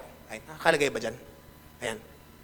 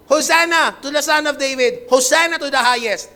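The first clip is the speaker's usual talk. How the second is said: loud, raised cry of acclaim; high, forceful imitation of a crowd's cry; crowd shouting; 4 semitones higher, 6 dB louder than usual. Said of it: "So yung mga tao sumisigaw".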